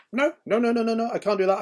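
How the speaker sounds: high, loud mock whine of refusal; hammed-up childish no, no, no; stubborn refusal. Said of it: "he'd be like".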